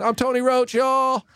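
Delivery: high, cartoonish roach voice; high comic sign-off.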